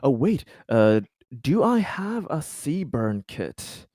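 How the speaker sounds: ordinary talk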